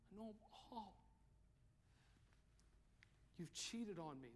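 lightly voiced God, hushed and grieved; quiet voice of God. God quietly telling the straying believer he knows everything. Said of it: "He says".

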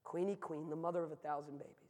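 subdued, softened retelling; hushed callback. Retold or imitated. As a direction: repeat the pitch quietly, with the show gone out of it. Grim callback to the barker's line.

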